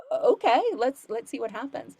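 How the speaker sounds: high, loud startled okay then settling; surprised okay. Retold or imitated. Teacher hearing of an accented student, surprised. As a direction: catch yourself, then agree. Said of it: "I was like".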